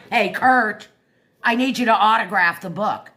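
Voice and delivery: re-enacted forthright demand; direct demand. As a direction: call out exactly what you want, no shyness. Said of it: "I'll tell you what I want".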